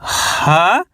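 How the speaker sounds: usual speaking voice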